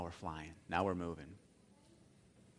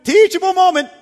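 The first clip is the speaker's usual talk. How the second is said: high, loud mock alarm call; 18 dB louder than usual. Dad's inner alarm going off, shrieking high and loud. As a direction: blare it like a siren going off. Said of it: "where you're like".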